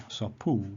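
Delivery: "so pu" with a long, accented PU; long accented final syllable. Shown as a demonstration. Teacher showing final perispomenon, long stress on "pu". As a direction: stretch the "pu" in "so pu". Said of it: "it can be a perispomenon if it's long".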